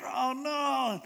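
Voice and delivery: high, drawn-out mock dismay; freaking out. King alone after hiding his worry, freaking out. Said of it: "He's just freaking out".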